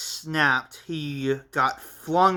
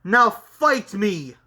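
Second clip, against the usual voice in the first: taunting challenge; 6 semitones higher, 4 dB louder than usual. Acted. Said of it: "Martin said with a smile".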